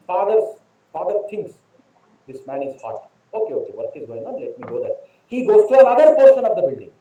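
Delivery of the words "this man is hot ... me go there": muttered, much softer and lower, to himself; hushed thought to himself; quiet inner thought. A narrator's reading. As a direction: drop the voice low as the father thinks it over. Father's inner thought, aside.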